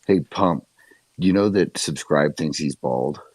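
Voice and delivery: ordinary talk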